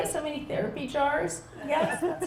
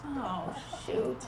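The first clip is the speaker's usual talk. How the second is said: ordinary delivery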